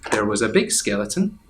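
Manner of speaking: usual speaking voice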